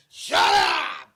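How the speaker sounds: high, very loud outburst; imitated yell. Comic imitating the star yelling in the street, loud shout. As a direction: shout it out in a goofy burst.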